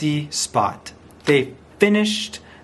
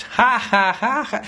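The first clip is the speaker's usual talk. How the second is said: high, spoken ha-ha laugh; acted laughter; 6 semitones higher than usual. A narrator's reading. The kids laughed a lot at George, acted laughter. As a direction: pretend to laugh like delighted kids. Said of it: "They laughed".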